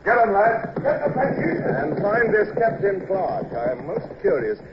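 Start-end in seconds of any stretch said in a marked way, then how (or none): 0.0-3.5 high-pitched rallying call; calling orders. Acted. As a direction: send the men off with a raised voice. Officer calls orders to his men.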